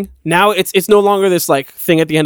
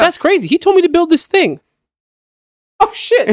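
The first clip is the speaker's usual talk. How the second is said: much higher, hammed-up astonishment; mock excited freak-out; 13 semitones higher than usual. Retelling a show scene, high mock freak-out. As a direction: play the stunned hero realising it all, high. Acts out a character's realisation.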